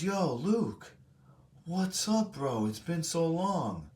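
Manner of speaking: high, surprised friendly greeting, slowing at the end; imitating Ray. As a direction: play the clueless buddy answering happily. Retelling Ray's cheerful phone greeting.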